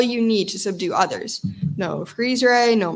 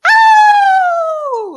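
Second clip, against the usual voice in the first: high loud whispery howl; breathy howl; 9 dB louder than usual. Acted. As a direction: howl from the diaphragm. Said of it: "From the diaphragm".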